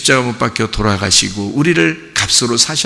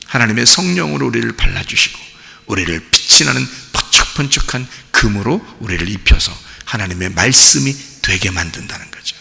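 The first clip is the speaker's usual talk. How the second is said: normal talking voice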